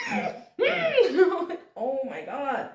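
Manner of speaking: laughing hard through the words; can barely get it out for laughing. Real, not acted. Owner laughing helplessly about her screaming dog.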